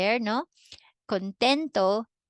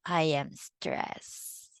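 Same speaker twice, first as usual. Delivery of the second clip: stressed; 3 semitones lower, 4 dB quieter, much slower than usual. Shown as a demonstration. Teacher demos stressed feeling.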